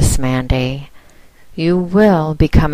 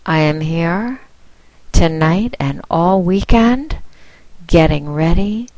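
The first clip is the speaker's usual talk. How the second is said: ordinary talk, nothing marked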